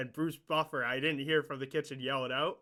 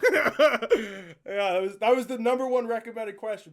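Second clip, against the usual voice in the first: laughing through the words, high and loud; real laughter bursting into a loud, high-pitched explanation; 9 semitones higher, 10 dB louder than usual. Real, not acted. Laughing at own outrageous question.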